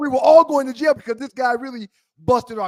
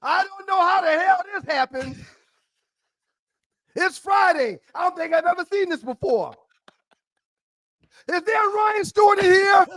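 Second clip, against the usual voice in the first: high, projected mock guard announcement; guard calling out; 8 semitones higher than usual. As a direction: call across a crowded cell like a baffled guard. Guard announcing surprise release, calling out.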